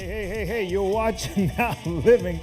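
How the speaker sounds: ordinary delivery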